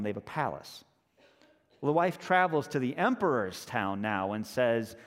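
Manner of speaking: normal talking voice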